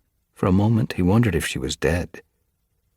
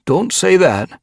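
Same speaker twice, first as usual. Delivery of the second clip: loud, high protest; alarmed; 8 dB louder than usual. A narrator's reading. Gorseclaw objects to Mothwing's gloomy words, alarmed. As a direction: cut in with sudden alarm. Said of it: "Gorseclaw looked alarmed".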